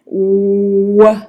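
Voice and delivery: W held with tight round lips; W sound. Shown as a demonstration. Teacher showing the W to a student, lips tight and round. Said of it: "Watch this".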